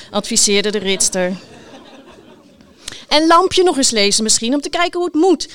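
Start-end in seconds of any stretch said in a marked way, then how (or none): 3.1-5.5 loud, high parting call; calling after someone. A narrator's reading. Knight advises the writer riding off, calling after him. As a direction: call it out after a departing cyclist. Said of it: "riep ze hem nog na".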